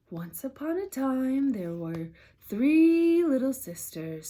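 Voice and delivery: plain reading voice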